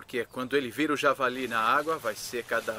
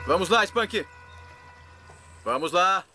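calling out; 6 semitones higher, 5 dB louder, slower than usual. Real, not acted. Coaxing the alligator to strike.

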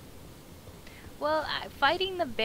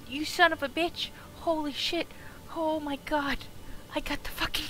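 normal talking voice

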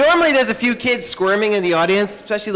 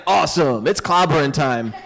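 lower, gruff-toned battle line; tough-guy Heracles; 6 semitones lower than usual. Retold or imitated. Heracles gets ready to smash, low and tough. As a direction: growl it like a brawler cracking knuckles.

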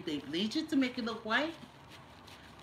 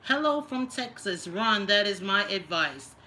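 hammed-up read of the troll comment; mock reading; 8 dB louder than usual. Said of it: "Heritage says".

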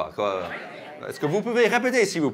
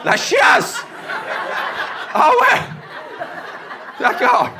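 surprised realisation; 6 semitones higher than usual. Real, not acted. Realises meaning of local slang.

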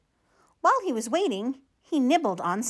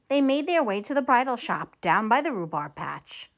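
ordinary delivery, nothing marked

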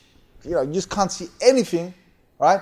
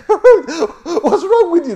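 high, slow, laughing question; laughing tease; 13 semitones higher, 7 dB louder, much slower than usual. Retold or imitated. Laughing friends teasing the grumpy van group, laughing. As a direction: tease them through laughter.

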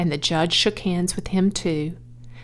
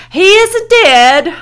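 high, loud, drawn-out holler; 12 semitones higher, 12 dB louder, slower than usual. A narrator's reading. Owner denies his pig is dead, high loud holler. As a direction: shout the denial across the ring. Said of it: "hollered Zuckerman".

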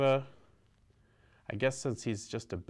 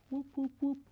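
wing flaps; 4 dB quieter, faster than usual. Pretend. Giving dragon blue wings.

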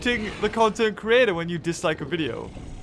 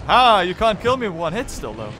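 ordinary delivery

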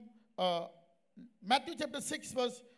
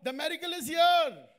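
raised, forceful preaching voice; emphatic urging; 3 semitones higher, 6 dB louder than usual. Retold or imitated. Preacher telling a sick woman of healing, emphatic. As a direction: proclaim it loudly.

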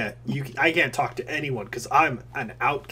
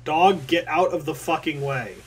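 louder, slow mock bark at the dog; angry yell at dog; 8 dB louder, slower than usual. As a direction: snap the dog out of the way. Yelling at dog in game.

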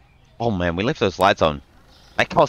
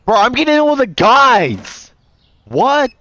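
much higher and louder indignant whine; outraged disbelief; 13 semitones higher, 6 dB louder than usual. Real, not acted. Shopkeeper complaining only guys visit, loud high indignation. Only male customers come in.

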